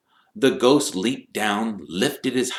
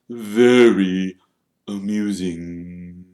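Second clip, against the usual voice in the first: slow, fading, unamused reply; dark Nearly Headless Nick; much slower than usual. A narrator's reading. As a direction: drag it out drily, dropping away. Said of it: "Nearly Headless Nick darkly".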